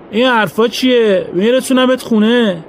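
high, slow, mocking reassurance; dismissive driver. A narrator's reading. Driver waving away Trzelkowski's refusal, with slow mocking calm. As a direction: brush off his fear with false kindness.

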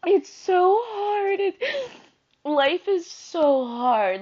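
slow, tearful, drawn-out words; seriously crying. Real, not acted. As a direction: start high and sink through the tears. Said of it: "seriously crying now".

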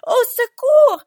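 desperate high-pitched scream; long, howled plea into the night; screamed cry for help. A narrator's reading. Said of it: "Je hurlai".

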